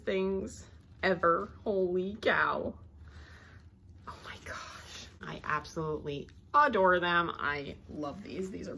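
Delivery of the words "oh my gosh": hushed, voiceless amazement; awed whisper. Real, not acted. Collector gazing at tiny deer, awed whisper. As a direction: whisper it in wonder.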